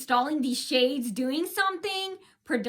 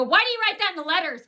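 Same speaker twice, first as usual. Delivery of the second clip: fast, louder, higher accusation; retold loud complaint; 6 semitones higher, 6 dB louder, faster than usual. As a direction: rattle it off at him, raised voice. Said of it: "I go".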